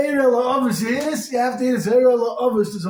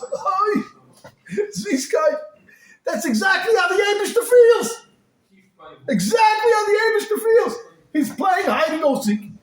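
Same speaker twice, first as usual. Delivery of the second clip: anguished outcry; 10 semitones higher than usual. Acted. Rebbe crying, preacher's passion.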